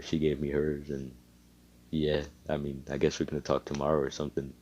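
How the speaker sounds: put-on soft, low, reserved boy voice; quiet, hesitant account; subdued explanation. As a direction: mumble it, playing it down. Shy boy plays down the meeting.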